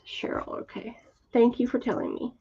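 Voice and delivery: soft whisper, off to the side; hushed thank-you under the breath; whispered aside. Real, not acted. Quiet aside to a viewer.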